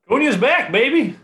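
hype-man shout; excited cheer. Real, not acted. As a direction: celebrate the player's return. Cheering about his player.

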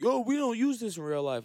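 high-pitched mocking quote; put-on voice of a doubter; mock objector. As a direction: imitate people complaining, pitched well up. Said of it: "when people be acting like".

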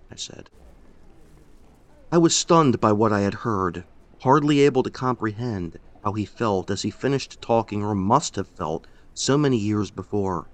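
ordinary delivery, nothing marked

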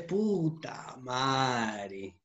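slow, heavy mock groan of regret the next day. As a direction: drag it out like a hungover morning-after. Hungover regret, acted self-quote.

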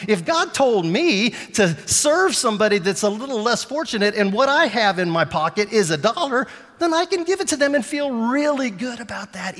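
full-force preacher; preaching at full force. Acted. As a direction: press the point hard.

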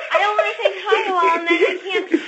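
fast giggly talk; can't stop laughing. Real, not acted. Daughter giggling, can't finish.

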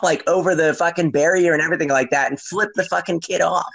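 usual speaking voice

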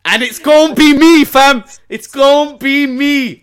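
high, loud triumphant crow; gloating shout. Real, not acted. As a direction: crow it like the winner. Gloating about Saturday's win.

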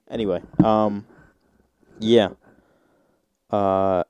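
normal talking voice

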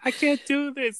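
mock panicky, high-pitched protest; shook.

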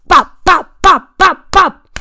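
loud high bopping beat; loud bop chant. Real, not acted. Coach chanting the beat for pedalling.

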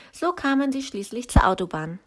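plain reading voice

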